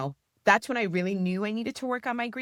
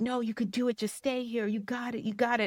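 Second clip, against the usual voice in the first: encouraging coach; 5 semitones higher than usual. Retold or imitated. Coach urging her to stay.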